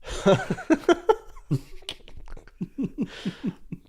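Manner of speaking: a sudden high laugh in disbelief; high burst of laughter. Real, not acted. Laughs at friend's franchise pick.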